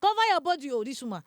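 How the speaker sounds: high, loud, fast scolding; a doctor telling a patient to cover up, hurried and loud. Retold or imitated. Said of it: "He said".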